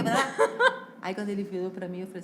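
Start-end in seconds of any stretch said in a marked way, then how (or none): none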